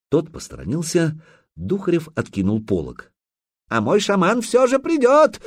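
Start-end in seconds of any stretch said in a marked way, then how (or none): 3.7-5.4 loud high shout; shouting after. Acted. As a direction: call out after someone leaving. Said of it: "крикнул ему вслед Курей".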